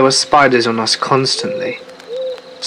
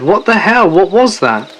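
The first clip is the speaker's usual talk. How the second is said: alarmed outburst; 7 semitones higher than usual. Retold or imitated. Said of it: "I shook him and said".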